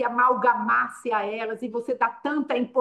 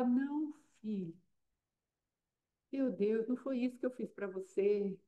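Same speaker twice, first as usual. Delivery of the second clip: much softer, hushed, gentle reproach; the Lord speaking tenderly; 10 dB quieter than usual. Acted. God gently telling his child, hushed and tender. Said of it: "E o Senhor fala".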